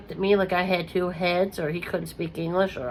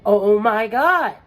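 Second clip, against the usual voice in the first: relieved joy; 3 semitones higher, 5 dB louder than usual. Retold or imitated. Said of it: "got in the car and was just like".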